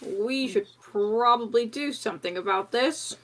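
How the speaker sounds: ordinary talk, nothing marked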